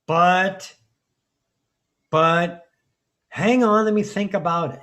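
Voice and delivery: loud, insistent interruption; acted-out urgent second thoughts; mock objection. Acts out the mind interrupting good feeling.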